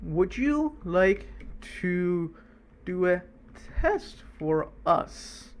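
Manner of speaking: drawn out slowly as if typing it; slow dictation. Shown as a demonstration. Teacher dictating the prompt slowly to students. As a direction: spell the prompt out slowly word by word. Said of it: "I'm gonna say".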